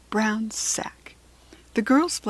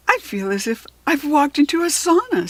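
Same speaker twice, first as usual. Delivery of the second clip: put-on higher, surprised girl; lightly voiced girl; 7 semitones higher, 5 dB louder than usual. A narrator's reading. Kirsty stepping into hot plain, surprised. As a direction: react to sudden heat.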